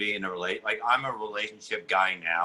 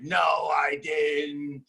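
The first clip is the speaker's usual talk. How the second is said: mock horrified shout; 3 semitones higher, much slower than usual. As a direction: recoil in mock horror, stretching it out. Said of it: "And I'm like".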